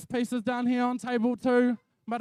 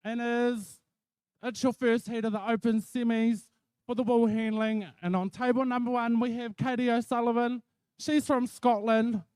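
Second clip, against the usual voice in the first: ordinary talk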